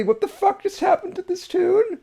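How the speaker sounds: high-pitched stunned disbelief; real shock. Real, not acted. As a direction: repeat it in total disbelief. Reacting to sudden song change.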